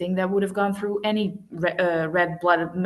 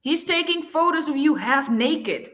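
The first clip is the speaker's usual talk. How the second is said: sharp, higher snap; snapped; 8 semitones higher than usual. A narrator's reading. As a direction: bite the words off at him. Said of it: "I snapped".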